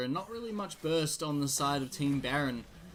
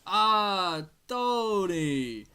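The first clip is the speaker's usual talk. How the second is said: hammed-up Italian accent, loud and high on Ah; a self-mocking Italian pizzeria owner accent; exaggerated Italian accent, louder; bad Italian accent; 4 semitones higher, 5 dB louder, much slower than usual. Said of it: "Sorry for my terrible Italian accent".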